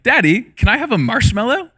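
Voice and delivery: put-on little girl asking. Dad imitates his six-year-old daughter begging for a marshmallow. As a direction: ask like a hopeful little girl.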